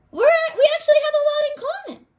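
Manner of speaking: high, quick ant voice pleading; high fast ant trying to win over the kid; hopeful little ant. Acted. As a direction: chirp it out like a tiny ant making friends. Ant voice in retell.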